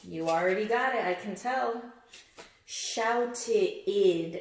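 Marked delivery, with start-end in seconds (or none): 2.6-4.4 Shouted with a drawn-out id at the end; Shouted with a long final id; stretched id ending. Shown as a demonstration. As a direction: linger on the id in shouted. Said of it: "It's kinda got that id".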